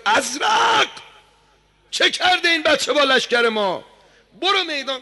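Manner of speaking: very high, loud commanding yell; a general screaming at his champion to fight; furious shout. Acted. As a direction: bark the order at Azraq in rage. Umar Saad yells at Azraq.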